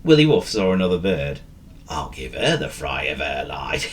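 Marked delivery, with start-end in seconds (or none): 1.9-3.9 low scheming line into a chuckle; lower, mischievous aside with a snigger; chuckling to himself. A narrator's reading. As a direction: plot a prank, then chuckle. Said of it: "he chuckled to himself".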